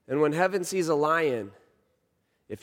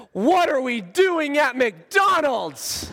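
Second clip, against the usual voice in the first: put-on high, loud, drawn-out kid's wail; upset child; 10 semitones higher, 5 dB louder, slower than usual. Crying son at the McDonald's driveway, slow high wailing protest. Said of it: "he's still crying about not getting breakfast".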